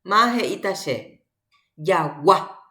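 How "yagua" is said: Yagua with a lifted, punched last A; stress on final A. Shown as a demonstration. Teacher asking class for tonic vowel, stress on final A. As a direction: hit the second A in Yagua. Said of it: "upepe pu ae ata".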